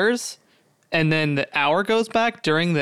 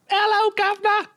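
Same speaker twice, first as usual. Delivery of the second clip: cartoonish high-pitched British "guvnor"; British accent. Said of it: "talk in a British accent".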